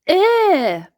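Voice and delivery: high, loud yuck; disgusted ew. Acted. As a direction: recoil from a smell. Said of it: "he cried".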